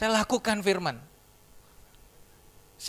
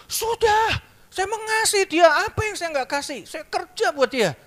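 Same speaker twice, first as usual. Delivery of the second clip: defensive retort; 10 semitones higher, 3 dB louder than usual. Retold or imitated. Husband defends himself to mentor.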